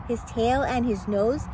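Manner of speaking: usual speaking voice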